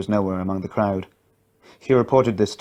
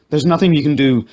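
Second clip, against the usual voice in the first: louder firm shout; 5 semitones higher, 6 dB louder than usual. A narrator's reading. Man shouts to mother, louder firm shout. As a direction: cut through her panic. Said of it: "shouted the man".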